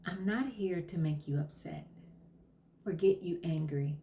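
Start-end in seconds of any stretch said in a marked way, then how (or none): none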